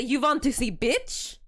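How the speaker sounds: put-on loud, slow Russian-accented threat. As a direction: scare them off in a heavy Russian accent. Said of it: "Because Russian accents scare people".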